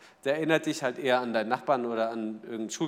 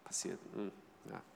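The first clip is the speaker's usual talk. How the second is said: much softer, unimpressed half-mutter; mildly annoyed; 7 semitones lower, 19 dB quieter, slower than usual. Acted. Coach reacting to Franz's mistake, mildly annoyed. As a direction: brush off the mistake with faint irritation. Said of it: "sagen wir, leicht genervt".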